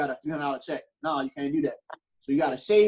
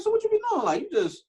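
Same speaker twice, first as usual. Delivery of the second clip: pitched way up, disbelieving; incredulous question. Retold or imitated. Asking his mom how she gets by, high and stunned. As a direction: ask it like you can't believe your ears. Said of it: "I'm like".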